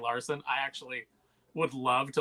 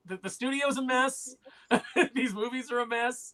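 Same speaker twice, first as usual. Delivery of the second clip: high, exasperated mock plea with laughter; defensive; 9 semitones higher, 8 dB louder than usual. Imitating an actress excusing the mess, defensive. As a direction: plead your case in a raised pitch.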